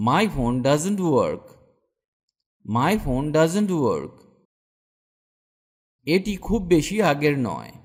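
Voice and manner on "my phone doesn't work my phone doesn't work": slow model phrase. Shown as a demonstration. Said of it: "আমার ফোন কাজ করছে না".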